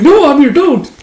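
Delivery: high, loud cry to stop; alarmed shout. Acted. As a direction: yell out in comic panic. Alarmed plea to stop Amir.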